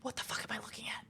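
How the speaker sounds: quick whispered bafflement; confused whisper. Retold or imitated. Gamer whispering confusion at screen pop-ups. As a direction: hiss it fast under your breath. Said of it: "and I'm like".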